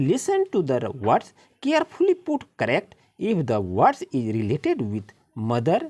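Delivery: reading the story aloud, word by word; slow, careful read-aloud for the class; slow passage reading. Acted. Teacher reading the passage to her pupils, slow and deliberate. Reads the English instructions aloud.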